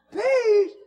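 very high, loud imitated cry; overwhelmed shout. Coworker shouting into the phone about newfound relief, high and loud. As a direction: cry it out at the top of the voice. Loudest repeat of the message.

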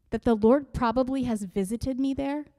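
ordinary talk

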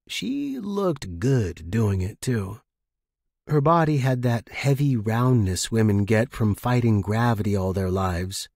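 plain reading voice

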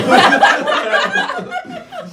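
very high, helpless laugh. Real, not acted. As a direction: laugh off the burn. Laughing after the wasabi burn.

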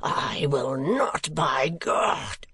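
put-on a drunk man hissing his outrage, then crying out; outraged demand. A narrator's reading. Sir Mulberry to the crowd after the blow, strained fury.

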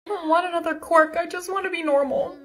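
high-pitched, plaintive protest; plaintive complaint. Acted. Girl who wants to be normal, plaintive. Skit character complains about her quirk.

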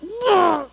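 high squealing "Wah!"; startled girl's squeal; girl's shriek. Retold or imitated. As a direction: shriek in a girl's voice like she saw something gross. Girl screams at the toilet.

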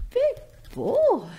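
very high cooing praise for the bird. Real, not acted. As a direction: coo it up high and sweet. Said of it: "repeated praise, plain then high".

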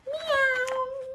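high, drawn-out mewing meow; cat meow. Acted. Cat arriving.

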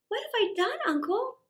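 put-on high, pleading little boy; a frightened boy pleading piteously with his uncle; piteous plea. A narrator's reading. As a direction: beg your uncle, hurt and pitiful. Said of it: "he said piteously".

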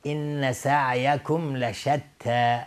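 slow recitation, thin t in لشتی; light ta. Shown as a demonstration.

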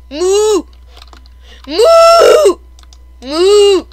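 loud, high mock moos; cow mooing. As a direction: moo loudly like a cow. Imitates cows at villagers.

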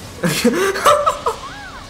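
high-pitched burst of laughter. Real, not acted. Player bowing out of the game, laughing.

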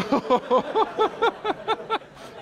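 high burst of real laughter; bursting into laughter at the joke. Real, not acted. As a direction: laugh out loud at the punchline.

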